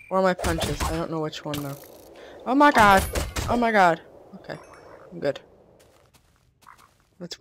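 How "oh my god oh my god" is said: loud, high startled oh my god; startled loud gasping cry. Real, not acted. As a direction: blurt it out in real fright. Sudden danger in game.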